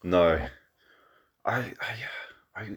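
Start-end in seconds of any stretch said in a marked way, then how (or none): 1.5-2.7 nervous whisper. Real, not acted. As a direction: whisper it, scared to speak. Afraid of saying a filler word.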